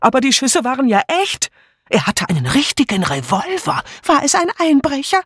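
high-pitched, alarmed guests; confused exclamations. A narrator's reading. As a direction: babble in high alarm after the shots. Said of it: "alle riefen wirr durcheinander".

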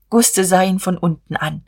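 plain reading voice

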